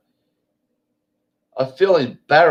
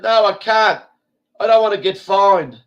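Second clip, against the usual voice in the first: imitating the woman; 8 semitones higher, 3 dB louder than usual. Woman refuses in retold quarrel.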